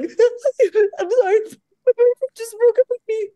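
high, loud, crying mock apology, stretched out; tearful apology. Arriving late on set, apologizing in loud mock tears. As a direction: sob the excuse through a laugh. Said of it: "I was like".

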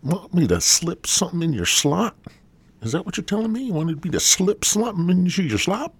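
mock the listeners, voice climbing up; mocking high voice.

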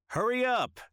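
high, loud impatient shout. Acted. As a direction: yell across the house. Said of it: "the guy shouted".

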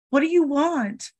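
the boy, voiced high and scared; imitating the boy. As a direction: squeak it like a frightened child. Boy's voice imitated.

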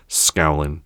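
ordinary delivery

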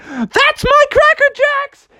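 very high, drawn-out holler; hammed-up proud vendor shout; elated frog cheer. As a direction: cheer in a squeaky falsetto, stretched. Froggy cheers the home run.

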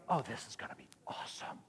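unvoiced hush of excitement; a whispered inner aside; whispered thought. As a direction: breathe it out as a secret thrill. Inner thought while on the cliff.

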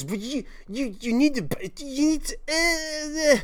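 put-on high, halting babble like a toddler; baby-like drunk babble. Imitating the drunk girl babbling like a baby who can't finish. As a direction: stumble over the words like a toddler. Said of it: "sounded like a baby who puts together their first sentence".

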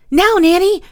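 high, loud call to his sister; shouting. A narrator's reading. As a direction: shout it out sharply. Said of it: "shouted George".

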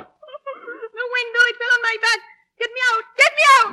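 high, panicked pleading. Acted. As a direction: panic while pinned down. Trapped under window.

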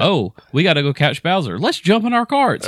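high, excited rallying cry; eager game character. Pretend. Acting out Mario racers chasing Bowser, excited shout.

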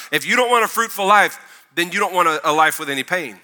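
loud, rising sermon build; preaching. Acted. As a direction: preach it with full force. Preaching at full force.

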